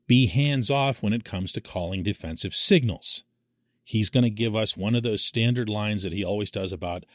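ordinary talk, nothing marked

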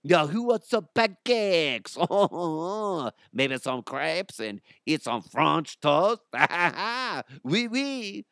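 put-on French accent. Host mimicking French listeners after their thank-you clip, hammed-up French accent. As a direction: put on a silly French voice, laughing between phrases.

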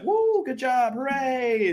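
very high parent cheer; mock celebration. Parent distracts fallen child, high exaggerated cheering. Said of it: "if you're just like".

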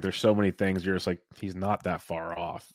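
ordinary talk

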